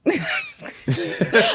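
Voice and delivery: a high burst of laughter. Real, not acted. Laughs at own fear.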